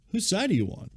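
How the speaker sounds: high-pitched, incredulous complaint; mock indignation. Sonny scolding his assistant over the fish, mock indignation. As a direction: play at being affronted, voice jumping up.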